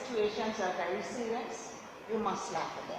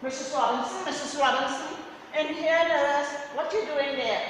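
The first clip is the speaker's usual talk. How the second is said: louder, higher calls of a name; nurse calling out; 6 semitones higher, 6 dB louder than usual. Retold or imitated. Nurse looking for the missing patient, loud calls. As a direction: search the rooms calling her name.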